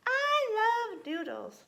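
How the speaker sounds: put-on high, loud, drawn-out little-girl declaration; little kitten declaring. A narrator's reading. Kitten girl declares her love of noodles, high and loud. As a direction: proclaim it high and slow. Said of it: "declared Jilly Bo".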